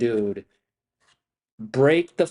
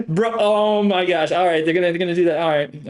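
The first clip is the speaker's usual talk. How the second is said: higher and louder, stretched out; can't believe what the chart is doing; rising excitement, nearly laughing; excited outburst; 6 semitones higher, 5 dB louder than usual. Real, not acted. Excited reaction to market move.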